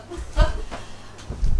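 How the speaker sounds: loud, high real laugh; burst of laughter. Real, not acted. Resident laughing as she introduces her village. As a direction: laugh out loud before going on. Audience member laughs before speaking.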